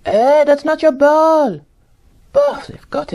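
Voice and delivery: high indignant shout; yelling at the TV. Acted. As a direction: protest at the screen. Watching game, yelling at screen.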